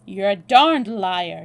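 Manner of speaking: loud, slow, hard accusation; drawn-out, heated charge; angry accusation. A narrator's reading. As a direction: call out the lie forcefully. Acted quarrel at gunpoint.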